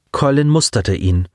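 plain reading voice

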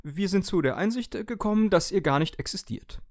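high, hesitant little appliance voice; hesitant toaster. Acted. Toaster hesitantly breaks bad news to owner, high voice. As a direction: clear the throat and tell him carefully. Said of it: "Zögernd räusperte sich der Toaster".

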